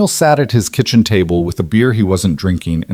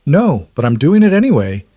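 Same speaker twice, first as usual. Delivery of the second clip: smiling; 6 semitones higher, 3 dB louder than usual. Acted. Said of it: "He could hear the smile in her voice".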